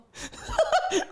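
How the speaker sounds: very high-pitched cracked-up laugh. Real, not acted. Reader cracking up at the boba question with a high laugh. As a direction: burst into a helpless high giggle. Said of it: "Why am I laughing at this?".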